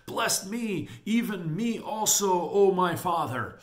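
high, loud dramatised plea; hammed-up wail of entreaty; bitter cry. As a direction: cry out to a father, begging. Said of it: "cried with a loud and exceeding bitter".

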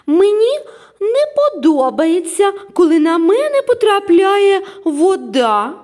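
loud, very high cross character voice; annoyed, drawn-out high protest; irritated complaint. A narrator's reading. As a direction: complain slowly and loudly, high-pitched. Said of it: "роздратовано зашипіла Келлі".

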